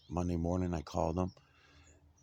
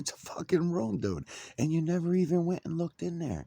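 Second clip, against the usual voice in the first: high, loud outraged rant; indignant yell; 11 semitones higher, 5 dB louder than usual. Retold or imitated. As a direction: hammer the point home, exasperated. Indignant retort to the landlord.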